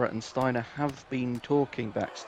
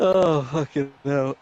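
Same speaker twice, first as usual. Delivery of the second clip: laughing groan; 3 semitones higher, 4 dB louder, slower than usual. Real, not acted.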